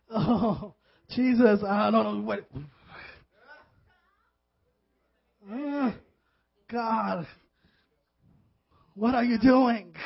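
loud, drawn-out comic pleading; mock exasperated plea. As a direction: plead with God in playful exasperation. Acting out exasperated plea to God.

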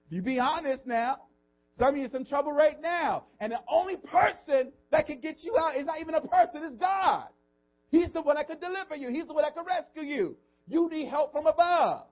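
high, loud, impassioned preaching; full-force preaching. Real, not acted. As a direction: drive it home to the congregation at the top of your voice.

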